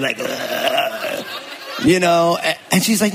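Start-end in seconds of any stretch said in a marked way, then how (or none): 0.2-1.2 mock gagging ugh, soft and laughing; a soft fake gag noise; gag sound. Husband rolling his eyes at his wife, mock gag. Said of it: "the gag sign".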